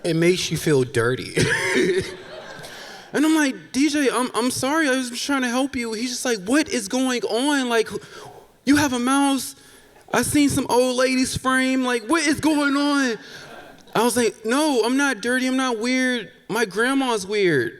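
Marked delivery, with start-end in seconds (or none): 3.7-5.9 high-pitched, hurried apology; pleading apology. Retold or imitated. Host apologising to the fallen date, high pleading voice. 6.5-13.2 high-pitched freaked-out demand; exasperated disbelief. Retold or imitated. Date confronting host about the mouse, high-pitched exasperated disbelief. 14.5-17.7 defensive protest. Retold or imitated. Host defends self to the date.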